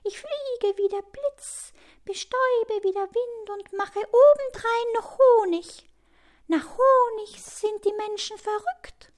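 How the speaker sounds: very high, put-on bee voice; high-pitched little show-off; boasting bee. A narrator's reading. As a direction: brag away in a tiny high voice.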